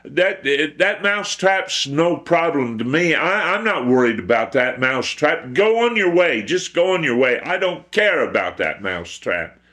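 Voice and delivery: high-pitched, dismissive chicken; haughty chicken. Acted. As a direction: brush the mouse off in a high chicken voice. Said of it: "the chicken stuck up its beak and said".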